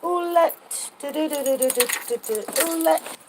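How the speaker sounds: sing-song calling out to a pet, high. Real, not acted. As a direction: coo the name up high.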